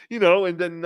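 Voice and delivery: ordinary delivery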